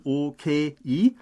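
"O, K, I" split syllable by syllable; three separate syllables. Shown as a demonstration. Explaining Korean okay, "O, K, I" in three distinct syllables. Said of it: "wären es drei Silben".

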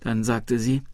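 usual speaking voice

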